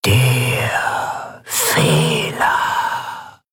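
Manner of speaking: extremely slow, stretched words; very slow, ominous repetition with a long pause; drawn-out echo. A narrator's reading. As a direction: let each word linger and fade. Phrase repeated as lingering echo.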